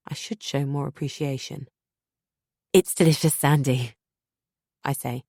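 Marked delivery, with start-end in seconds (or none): 2.7-3.9 forced smile. Acted. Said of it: "plastering a smile on my face".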